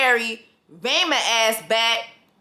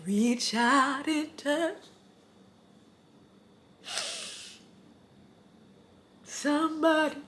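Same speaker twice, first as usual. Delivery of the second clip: hammed-up choked-up voice, slow; mock tearful, drawn-out; mock emotional; 9 dB quieter, slower than usual. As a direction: pretend to be moved to tears. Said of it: "I got emotional".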